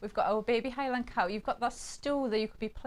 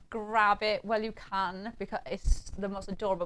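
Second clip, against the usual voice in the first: ordinary talk, nothing marked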